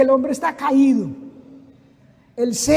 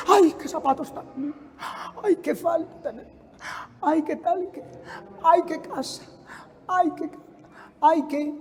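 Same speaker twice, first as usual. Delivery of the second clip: soft, higher envious gasping; envious person; 3 semitones higher, 7 dB quieter than usual. Acted. Envious onlooker eyeing others' clothes and houses, hushed mock envy. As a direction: coo enviously, in broken-off little bursts. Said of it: "El envidioso envidia todo lo que ve".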